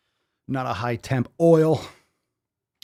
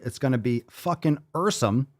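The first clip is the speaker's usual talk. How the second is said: usual speaking voice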